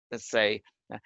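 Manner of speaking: usual speaking voice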